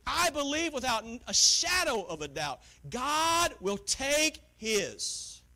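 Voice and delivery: loud, high fervent preaching; preaching at full force. Real, not acted. Preacher thundering to the congregation about giving. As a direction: preach it with fire.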